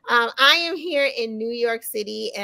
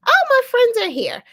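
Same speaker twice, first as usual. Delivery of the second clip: excited; 13 semitones higher, 7 dB louder than usual. Real, not acted. Host spots guests arriving.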